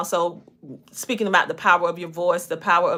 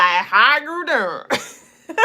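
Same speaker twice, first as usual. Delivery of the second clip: slow, drawn-out Southern twang, pitched up; Southern country twang; 5 semitones higher, 3 dB louder, much slower than usual. Retold or imitated. Showing off her Southern twang to viewers, slow drawn-out Southern accent. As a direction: stretch the greeting out in a thick Southern twang. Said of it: "that country twang be like".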